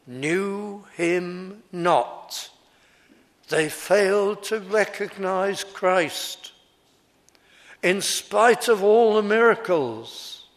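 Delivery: preacher stressing the people's blindness; rising preaching climax. Acted. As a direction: swell the words toward a cry.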